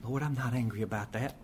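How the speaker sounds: murmured prayer hiding anger; fake calm prayer. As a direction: pretend to be at peace while seething. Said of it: "act not angry".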